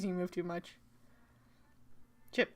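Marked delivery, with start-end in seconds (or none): none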